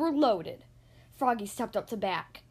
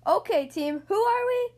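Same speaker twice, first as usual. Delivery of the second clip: coach calling out; 7 semitones higher, 4 dB louder than usual. Acted. Coach rallying team.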